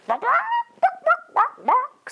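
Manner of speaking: high squawking clucks; chicken noises. Acted. Frightened chickens squawking at Marvin, high squawks. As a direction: squawk like startled hens. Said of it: "said the chickens".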